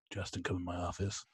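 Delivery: low, quiet summons; stern principal. Retold or imitated. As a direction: imitate a principal calling a student in. Imitating the principal summoning him.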